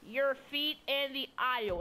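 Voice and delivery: slow, drawn-out bus announcement with a stutter; exact mic imitation. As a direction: mimic the bus driver's mic call word for word. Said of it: "that's exactly how she said".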